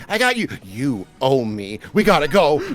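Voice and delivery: normal talking voice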